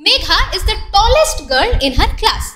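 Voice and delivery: very slow, word by word example sentence; slow reading aloud. Shown as a demonstration. Teacher reading an example sentence to pupils, very slow. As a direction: spell it out slowly for the class. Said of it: "চলো তোমরা প্রথমে এই তিনটি বাক্য পড়ো তো".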